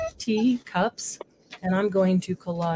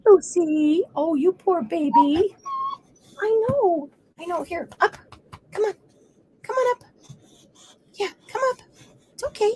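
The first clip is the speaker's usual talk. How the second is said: doting pet-talk; 9 semitones higher than usual. Real, not acted. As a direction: comfort the dog and coax it up. Comforting and coaxing her dog.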